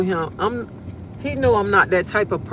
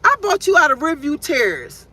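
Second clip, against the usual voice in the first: voicing God; 7 semitones higher, 4 dB louder than usual. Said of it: "God was like".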